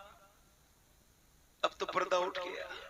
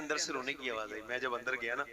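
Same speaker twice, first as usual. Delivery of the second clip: ordinary delivery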